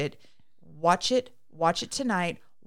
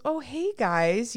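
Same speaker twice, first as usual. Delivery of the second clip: higher, drawn-out acted hello; mock friendly greeting; 6 semitones higher, 4 dB louder, slower than usual. Retelling Gus coming outside to the couple, mock friendly greeting. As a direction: sing out a casual greeting, slow.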